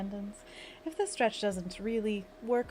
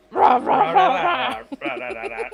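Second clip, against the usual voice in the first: loud, higher playful monster rah; monster claw growl; 5 semitones higher, 12 dB louder, much slower than usual. Shown as a demonstration. Streamer leading viewers in a wrist stretch, playful monster roar. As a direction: roar like a cartoon monster while clawing. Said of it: "what we call a monster claw".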